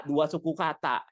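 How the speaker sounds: ordinary talk, nothing marked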